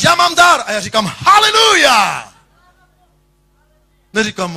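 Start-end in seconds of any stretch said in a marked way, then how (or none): 0.0-0.6 proud claim. Retold or imitated. Quoting a believer's claim. 1.2-2.3 slow drawn-out haleluja, much higher and louder; joyful shout. Acted. Preacher answering the gifted brother, shouting praise.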